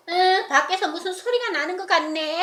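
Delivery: put-on girl's voice. Girl inside answers.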